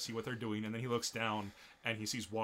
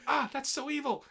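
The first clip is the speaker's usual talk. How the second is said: high, loud delighted squeal; squealing girl; 8 dB louder than usual. Retold or imitated. Girl reacting to her friend's insult, gleeful high squeal. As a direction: squeal it like a giggly anime girl.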